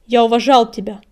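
loud, clearly enunciated statement; firm, deliberate declaration; loud and distinct. A narrator's reading. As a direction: speak up, pronouncing every word. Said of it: "громко сказал он, отчётливо выговаривая каждое слово".

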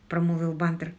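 usual speaking voice

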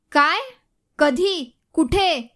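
loud alarmed questions at once; shocked loud cry. A narrator's reading. Sharvari shouting into the phone on hearing of the accident, loud and shocked. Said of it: "Sharvari इतक्या मोठ्याने म्हणाली".

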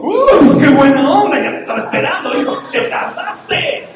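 loud, high, hammed-up relief; over-the-top comic exclamation; mock relieved outburst. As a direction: burst out in exaggerated relief, scolding the late rescuer. Mock relieved Abraham, what he didn't say.

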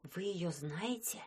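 soft, awed whisper. Acted. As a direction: whisper it behind her back. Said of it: "шепталась за её спиной нечисть".

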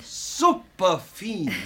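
slow, savoured approval; drawn out. Acted. Dressmaker praising the fitted dress, slow and pleased. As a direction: stretch it out with delight.

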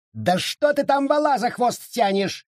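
high-pitched, exasperated squeal; acted judge's indignant squeal; squealed complaint. A narrator's reading. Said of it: "взвизгнул судья".

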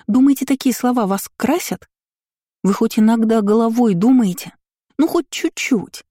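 high-pitched, exasperated telling-off; indignant scolding. A narrator's reading. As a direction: scold younger sisters in rising exasperation. Older sister angrily scolds the twins.

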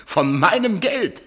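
a contemptuous jab at the wife; sneering laugh. Acted. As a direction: twist the knife. Husband sneers at wife's plans.

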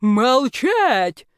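loud, drawn-out commanding roar. A narrator's reading. Rector silences the squabbling students, loud roar. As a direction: bellow the order to stop. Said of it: "взревел ректор".

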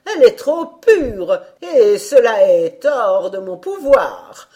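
put-on slow, loud and solemn old fairy; loud fairy declaration. A narrator's reading. Old fairy loudly tells the stepmother her limits, slow and solemn.

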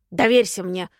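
plain reading voice

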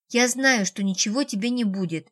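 ordinary delivery, nothing marked

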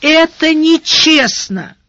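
по-детски обиженно, медленно и выше; протянуть с ребячьей обидой на несправедливость; детская обида. A narrator's reading. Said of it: "выдохнула с детской обидой". (с детской обидой, протяжно)

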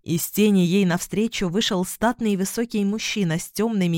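plain reading voice

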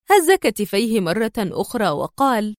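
usual speaking voice

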